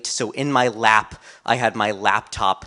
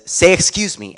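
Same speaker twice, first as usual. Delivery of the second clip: loud, much higher retold command; angry command; 8 semitones higher, 6 dB louder than usual. A man on the subway scolding the narrator, loud angry command. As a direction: snap it at the kid who bumped into you and the baby. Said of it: "And the man says".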